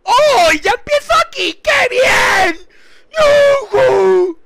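high, loud cheering; joyful whoops. Real, not acted. Streamer cheering as the game starts, loud whoops. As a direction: whoop with delight.

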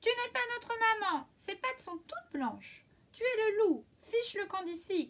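high, loud little goats yelling; kids shouting. A narrator's reading. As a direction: shout the wolf away. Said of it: "crièrent les chevreaux".

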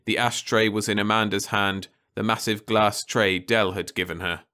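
usual speaking voice

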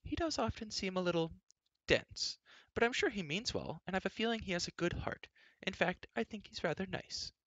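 high, light voice defending someone; princess voice. A narrator's reading. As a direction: speak up high as a candid princess. Narrator voices the princess in dialogue.

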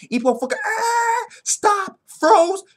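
high-pitched yelling play-by-play; climactic excitement. Retold or imitated. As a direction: shriek through the big moment. Reenacts the climactic move.

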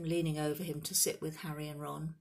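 ordinary delivery, nothing marked

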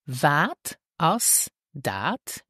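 "Wat as dat" drawn out with gaps between words; slow, word by word. Shown as a demonstration. Teacher models the question for learners, slow and separated.

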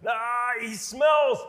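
loud, high, drawn-out mock protest; protesting in disgust. Onlooker objecting to opening the tomb, loud disgusted protest. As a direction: balk loudly, stretching the words out. Acted objection to removing the stone.

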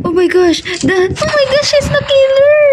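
startled high-pitched discovery; shocked realization. Real, not acted. Realizing who the killer is.

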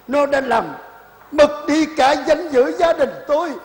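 building to a high, loud, slow cry; anguished outcry. Acted. Old man calls on his dead friend's spirit, anguished rising shout.

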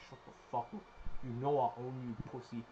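low, soft contemptuous taunt; quiet menace. Real, not acted. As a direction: hiss insults quietly.

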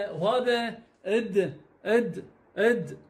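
slow drilled letter sounds. Shown as a demonstration. Teacher drilling the letter sound for pupils, slowly. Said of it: "ع".

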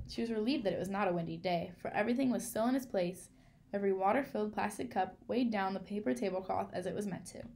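ordinary delivery

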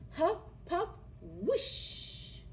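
huff puff whoosh. A narrator's reading. Wolf blows house down.

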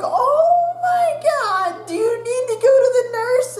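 teacher urging student to the nurse, alarmed; teacher's alarm. Retold or imitated. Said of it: "they're like".

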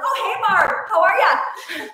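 playful Minnesotan mom voice, laughing after; Minnesota mom accent. Acted. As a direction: greet a neighbor like a Minnesota mom. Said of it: "we did that Minnesota mom reel".

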